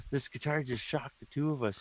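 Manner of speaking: warning the third guy; lightly voiced bandmate; mock bandmate warning. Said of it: "the guy goes".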